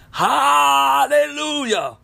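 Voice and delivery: high, slow drawn-out cry of praise; ecstatic hallelujah. Real, not acted. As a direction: cry it out in rapture.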